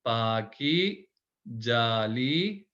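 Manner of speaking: each word stretched very slowly; stretched practice drill. Shown as a demonstration. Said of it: "तो आप इसको practice करें दोबारा".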